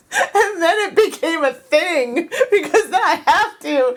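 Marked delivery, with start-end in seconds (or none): none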